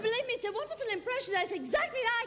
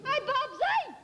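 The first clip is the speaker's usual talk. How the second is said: usual speaking voice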